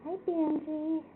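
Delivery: ordinary talk, nothing marked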